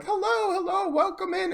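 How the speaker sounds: high sing-out greeting; imitated chat welcome. Chat regulars welcoming a newcomer, high-pitched and eager. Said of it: "you guys all were like".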